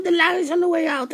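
ordinary talk

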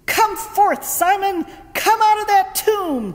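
high, loud command into the tomb; commanding call. Retold or imitated. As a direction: order the dead man out, full voice. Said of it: "Joshua commanded".

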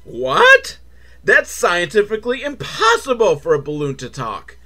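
high, startled disbelief; incredulous Green Oogie. Acted. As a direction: balk in cartoonish outrage. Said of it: "Green Oogie is like".

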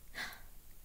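small, quick intake of breath; soft gasp. A narrator's reading. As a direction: gasp lightly in surprise. Boy's gasp of realisation.

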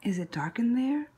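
usual speaking voice

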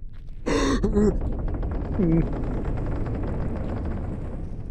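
sharp, long gasp of fright; frightened gasp. Real, not acted. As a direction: gasp in terror. Sees the big cat.

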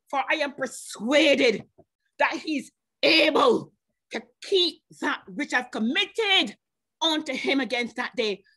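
drawn-out, weighty preaching of scripture; preacher declaring the verse to the congregation, slow and solemn. Acted. Preacher quotes scripture slowly.

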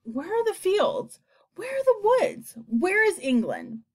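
plaintive, rising dismay; distressed cry. A narrator's reading. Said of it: "they cried".

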